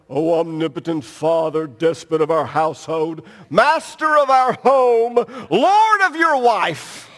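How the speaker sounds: hammed-up thee-and-thou plea to Dad; mock pompous child's prayer. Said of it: "imagine Micah or Macy coming up to me and saying".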